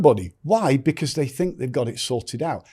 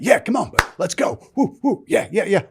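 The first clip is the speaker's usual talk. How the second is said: much higher, whooping class leader; hyped fitness instructor; 9 semitones higher, 3 dB louder than usual. Retold or imitated. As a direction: pump up the class like a peppy instructor. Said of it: "classes where it's like".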